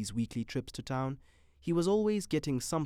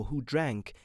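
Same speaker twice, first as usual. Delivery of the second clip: ordinary delivery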